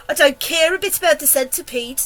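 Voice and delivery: sobbed. A narrator's reading. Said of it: "the earthworm sobbed".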